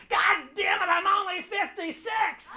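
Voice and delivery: high, slow, outraged mother voice; mock-furious mother shouting from the crowd; indignant mother yelling. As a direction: shriek it like an offended mom. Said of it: "stood up in the middle of Las Vegas and said".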